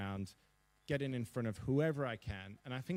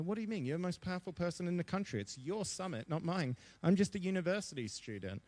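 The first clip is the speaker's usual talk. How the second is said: pitched higher, fast, retelling his own disbelief; incredulous protest; 7 semitones higher than usual. Retold or imitated. Student protesting to the Prime Minister, higher and fast with disbelief. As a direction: replay the young student's disbelief, higher and quicker.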